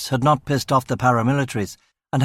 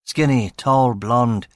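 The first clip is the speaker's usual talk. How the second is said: ordinary delivery, nothing marked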